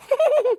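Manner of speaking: loud high laugh burst; sharp high laugh. Real, not acted. Preacher laughing at the centurion line, loud laugh.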